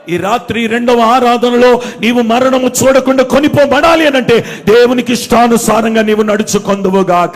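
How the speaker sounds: loud, sustained proclamation; full-force preaching. Real, not acted. Preacher blessing the night service congregation, full-force preaching. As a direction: pronounce the blessing with big volume.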